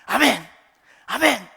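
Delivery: loud, eager cries of amen; hammed-up fervent amen from a sickbed; eager shouted amen. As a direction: shout amen like a man grabbing salvation.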